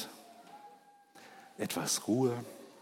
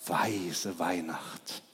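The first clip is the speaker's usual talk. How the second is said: softer, slow dreamy sigh; enraptured; 5 semitones lower, 7 dB quieter, much slower than usual. Acted. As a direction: breathe it out in rapture. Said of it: "schwärmte verzückt". (verzückt, leise und langsam geschwärmt)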